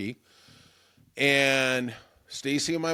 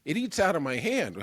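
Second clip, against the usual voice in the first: higher, sweet squeaky imitation; imitating Stacy; 8 semitones higher than usual. Host mimics producer gushing about her squirrel, high and sweet. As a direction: gush like a proud squirrel mom. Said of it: "She goes".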